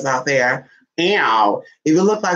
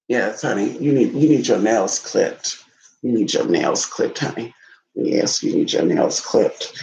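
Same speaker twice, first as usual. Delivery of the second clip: low pet-talk; 6 semitones lower than usual. Real, not acted.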